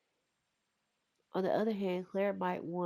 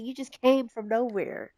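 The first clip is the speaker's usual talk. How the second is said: mock taunt; 5 semitones higher, 8 dB louder than usual. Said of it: "He said".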